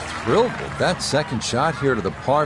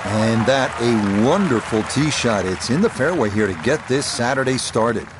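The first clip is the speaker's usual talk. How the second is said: golf commentator. Acted. Live golf broadcast play-by-play.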